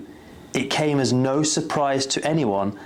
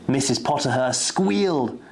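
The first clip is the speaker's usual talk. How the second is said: ordinary delivery, nothing marked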